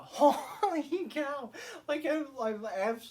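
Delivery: high, loud and laughing, tripping over words; laughing amazement. Real, not acted. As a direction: gush helplessly through laughter. Overcome with enthusiasm for ending.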